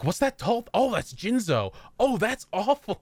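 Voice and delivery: high surprised gasp; mock surprised. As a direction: act startled by a tall monster. Recalled reaction to Jinzo.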